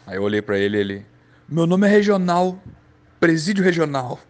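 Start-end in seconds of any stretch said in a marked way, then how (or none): 1.5-4.2 yelling the line. Retold or imitated. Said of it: "ficava gritando só isso, bem alto".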